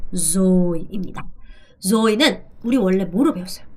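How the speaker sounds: normal talking voice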